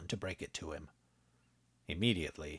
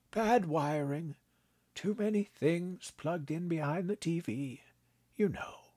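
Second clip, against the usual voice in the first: put-on a kindly old man, gently amused; old grandpa voice; 11 semitones higher, 3 dB louder than usual. A narrator's reading. Grandpa teasing his grandson, kindly old voice.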